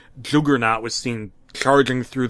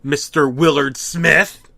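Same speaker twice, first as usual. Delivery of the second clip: menacing; 2 semitones higher, 8 dB louder, slower than usual. Acted. Creepy reveal ending.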